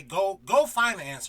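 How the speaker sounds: mock-scolding send-off; imitated impatient mom. Mom shooing her curious son away, imitated impatience.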